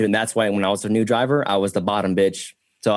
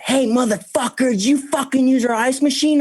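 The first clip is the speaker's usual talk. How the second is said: high shrill-free mock yell of the daughter, much higher; mock angry daughter; 14 semitones higher than usual. Daughter cussing him out over the ice machine, mocked high.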